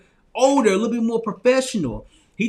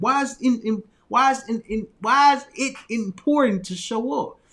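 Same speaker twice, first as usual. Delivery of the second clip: usual speaking voice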